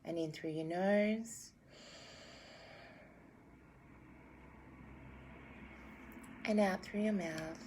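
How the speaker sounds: calm meditation guide; guided meditation. Acted. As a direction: guide them gently into breathing.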